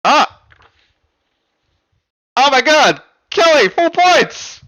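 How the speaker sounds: loud, high whoop of real amazement; excited shout. Real, not acted. Host cheering Kelly's full-points answer in an excited shout. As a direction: cry out, stunned and thrilled.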